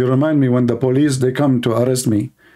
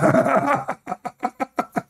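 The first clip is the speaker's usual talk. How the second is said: high laugh; 11 semitones higher, 4 dB quieter than usual. Real, not acted. Laughs at own story.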